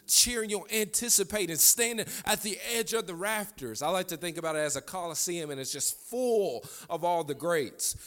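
high, rising sermon fervour; building preaching. Real, not acted.

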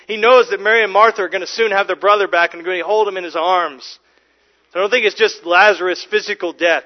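fervent preaching. Acted. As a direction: drive it home with rising joy.

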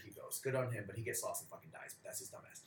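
ordinary talk

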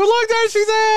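high, loud excited squeal; squealing fangirl. Pretend. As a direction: squeal like a thrilled young fan. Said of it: "Does she squeal?".

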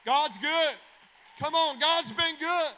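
loud, high shouted praise; full-force preaching. Real, not acted. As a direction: whip the congregation up, shouting.

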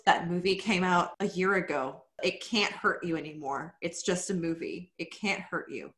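soft, soothing therapist tone. Pretend. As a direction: talk her down gently like a therapist. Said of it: "I'm therapist voice".

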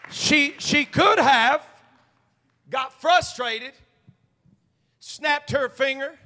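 slow, loud lead-in to a sassy retort; building sass. Acted. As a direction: wind up like she's about to snap. Sassy woman scenario.

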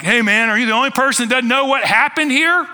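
high, loud question climbing to the end; incredulous disciple. Acted. Disciple to the unrecognized Jesus on the road, high-pitched disbelief.

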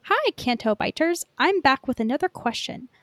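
ordinary delivery

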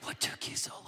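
officer's last plea, whispered in grief; broken whisper. Retold or imitated. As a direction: let the question fall to a whisper. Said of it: "he starts weeping and he says".

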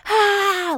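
drawn-out high "ah" imitating someone yelling; imitated yell. Storyteller imitating a yelling person in the cells, mock yell. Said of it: "like, yelling".

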